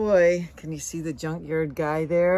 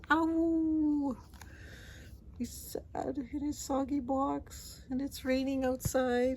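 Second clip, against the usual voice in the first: pitying coo; 9 semitones higher, 5 dB quieter, slower than usual. Real, not acted. Pitying sad dog in rain.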